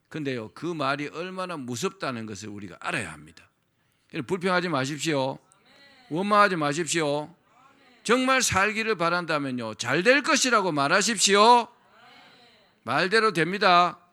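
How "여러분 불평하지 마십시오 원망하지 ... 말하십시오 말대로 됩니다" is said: louder, rising exhortation; forceful preaching. Real, not acted. Pastor urging the congregation to stop complaining, full-force preaching. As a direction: drive the call home to the crowd.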